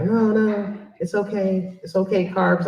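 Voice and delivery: usual speaking voice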